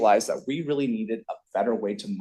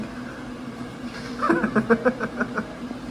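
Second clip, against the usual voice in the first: high, airy villain cackle; evil laugh; 11 semitones higher than usual. Pretend. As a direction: cackle like a mad scientist. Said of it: "That's my evil laugh".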